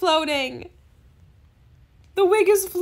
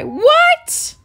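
high-pitched squeal of "what"; real shock, pitch shooting right up; stunned disbelief; 3 dB louder than usual. Real, not acted. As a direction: shriek it in shock. Reacts to surprise gift offer.

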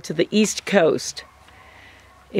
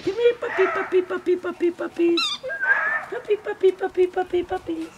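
high sing-song puppy call; calling a dog; 12 semitones higher, 4 dB quieter, faster than usual. Real, not acted. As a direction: coax the pups over, very high. Calling pups over to camera.

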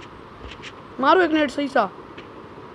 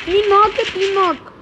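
high, loud knock callout; excited callout; 9 semitones higher, 6 dB louder than usual. Real, not acted. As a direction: call out the knocks, voice jumping up. Calls out knocks in game. (जोश में ऊँची आवाज़ में)

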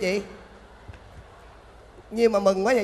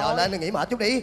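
ordinary delivery

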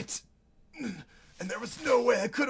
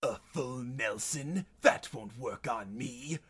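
quiet, low disbelief; soft, deep, unimpressed villain; low scoff; 7 semitones lower, 12 dB quieter than usual. Acted. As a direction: dismiss it under your breath.

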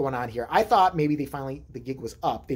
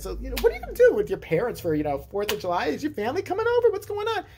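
chirpy stranger; 8 semitones higher than usual. Retold or imitated. Mimics stranger asking about family.

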